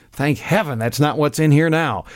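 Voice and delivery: ordinary talk, nothing marked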